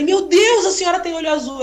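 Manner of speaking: dazzled by blue eyes; amazed exclaim. Retold or imitated.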